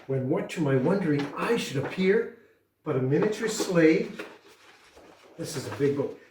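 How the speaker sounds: ordinary delivery, nothing marked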